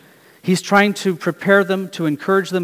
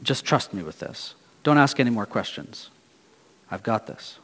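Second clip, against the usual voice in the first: softer, lower, closing the matter; a hushed, lower-voiced I've got this; quiet assurance; 5 semitones lower, 6 dB quieter than usual. Someone telling you to stop asking, softer and lower. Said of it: "anyone ever has to say to you".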